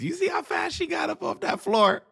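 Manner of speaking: amazed, high-pitched shout; shouting in disbelief at the replay; excited shout. Real, not acted. Commentator amazed at grandma.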